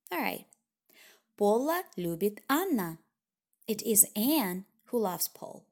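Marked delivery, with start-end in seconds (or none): 1.4-3.0 Анна hit hardest at the end, falling; stress on Анна. Shown as a demonstration. Teacher showing that the final Анна carries the emphasis. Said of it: "emphasis at the end of the sentence". 3.7-5.6 Anne pushed forward, falling; single out Anne; stress on Anne. Shown as a demonstration. Teacher translating, stressing Anne as the one who loves. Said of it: "not anybody else".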